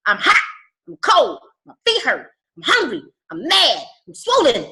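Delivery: loud, high, cranky complaining, cracking to a cry at the end; woman in labor. Retold or imitated. Coach voicing a mother in labor to her team, loud complaining. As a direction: wail out each complaint like a miserable mother in labor. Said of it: "it's like".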